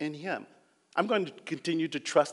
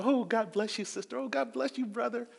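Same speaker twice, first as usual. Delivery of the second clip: sweet greeting; 7 semitones higher, 5 dB quieter than usual. Retold or imitated. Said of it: "new church, everybody loves".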